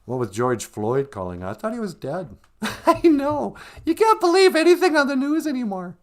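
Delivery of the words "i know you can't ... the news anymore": high, loud squeaky puppet voice; squeaky mock voice. Sidekick teasing the host in a high, loud squeak.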